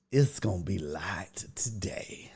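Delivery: slow, soft whisper. Shown as a demonstration. Telling viewers the stream is light, whispered. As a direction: whisper it like a secret. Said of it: "well, it's gonna be light today".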